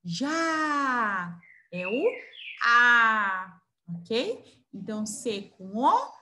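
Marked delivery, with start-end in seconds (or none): 0.0-1.4 "Já" held long on the A; teacher sounding out a sustained "Já"; syllable demo. Shown as a demonstration. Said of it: "pra formar o já". 2.6-3.6 "A" loud and held long; teacher's drawn-out "A"; vowel demo. Shown as a demonstration. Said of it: "eu preciso do".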